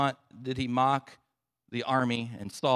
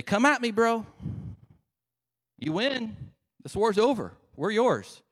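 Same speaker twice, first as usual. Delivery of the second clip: high, raised-voice mock challenge; taunting; 9 semitones higher than usual. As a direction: goad them like a trash-talker. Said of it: "taunt them".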